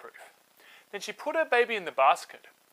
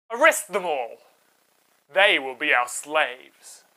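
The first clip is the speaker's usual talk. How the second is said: scheming king; 6 dB louder than usual. Acted. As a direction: build the tyrant's fury to a shouted order. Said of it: "The king said".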